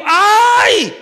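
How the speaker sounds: put-on sharp high yelp of a hurt child; a son crying out from the bedroom; child's cry of pain. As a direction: cry out like a hurt little boy. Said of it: "Se ele dá um grito".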